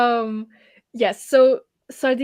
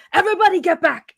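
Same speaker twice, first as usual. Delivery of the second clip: loud, higher order to the crowd; dwarf orders the crowd back; commanding shout; 7 semitones higher, 8 dB louder, faster than usual. Acted.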